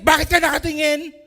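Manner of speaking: loud, high, offended bark; mock angry challenge. Preacher mimics a touchy person glaring at a mere look, loud offended challenge. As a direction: throw it out like someone picking a fight.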